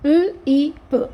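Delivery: "ల్, ఈ, ప" as three separate sounds; sounded out. Shown as a demonstration. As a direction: break "ల్, ఈ, ప" into l, ee, short p. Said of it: "వీక్ సౌండ్స్కి ఒక vowel sound".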